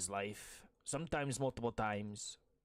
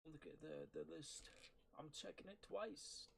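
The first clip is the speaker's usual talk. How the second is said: quiet singsong; 5 semitones higher, 17 dB quieter than usual. Acted. Quiet Santa aside.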